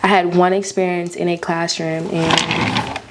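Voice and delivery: normal talking voice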